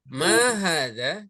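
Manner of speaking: "Ma hadza" slow, long "Ma", rising at the end; question intonation. Shown as a demonstration. Teacher has students repeat the drill, questioning "Ma hadza". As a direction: ask "Ma hadza" drawn out for the class to copy.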